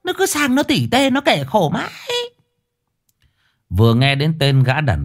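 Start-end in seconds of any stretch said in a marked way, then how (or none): 0.0-1.9 high, fast chattering wife; fussy old wife. Acted. Wife character voice.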